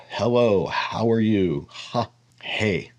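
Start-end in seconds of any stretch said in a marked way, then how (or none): 1.7-2.9 "Ha, hey" with airy H, softer; H sound shown; breathy H demo. Shown as a demonstration. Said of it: "H sound".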